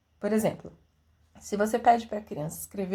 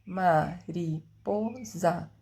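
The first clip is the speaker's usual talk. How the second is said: Mariposa drawn out very slowly, syllable by syllable; 3 semitones lower, much slower than usual. Shown as a demonstration. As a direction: sound out each syllable like a child counting. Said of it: "Quatro sílabas".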